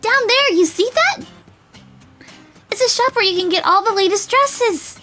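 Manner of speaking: put-on high, loud, eager girl voice; bubbly, high-pitched enthusiasm; excited girl. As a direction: gush like a girl showing off her town. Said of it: "suddenly looking really excited".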